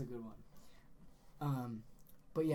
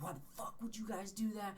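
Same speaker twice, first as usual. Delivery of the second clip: mock angry rant in a raised pitch; mad; 8 semitones higher, 3 dB louder than usual. Pranked friend confronting his buddies, mock mad rant. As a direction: imitate a furious friend, dissolving into blustering noise. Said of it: "he was so mad".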